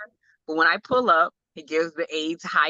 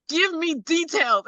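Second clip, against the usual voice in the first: high, drawn-out mock demand; playful exasperation; 11 semitones higher, slower than usual. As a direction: press a kid for more, slow and high. Mother pressing son for an answer.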